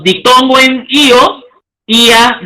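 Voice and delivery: normal talking voice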